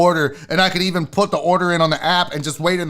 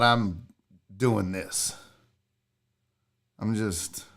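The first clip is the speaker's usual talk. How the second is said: ordinary talk, nothing marked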